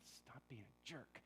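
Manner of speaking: slow, soft put-down; quiet retort. Retold or imitated. Friend on the wall, quietly slow retort to the narrator. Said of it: "he looked at me and he said".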